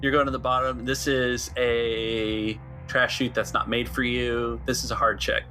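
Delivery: ordinary talk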